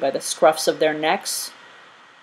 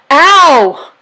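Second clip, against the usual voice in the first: high, loud sudden "Ow!"; jolted awake by a peck; yelp of pain; 9 semitones higher, 11 dB louder than usual. A narrator's reading. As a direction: innkeeper pecked on the toe, yelping. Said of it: "yelled Wiggins".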